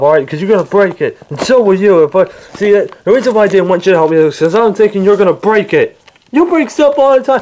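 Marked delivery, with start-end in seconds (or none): 6.3-7.4 high-pitched, indignant accusation; raised pitch, fed up; heated accusation. Acted. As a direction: throw the blame back in an argument. Quarrel over breaking things.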